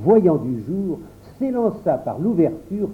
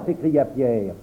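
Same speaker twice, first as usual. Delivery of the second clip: plain reading voice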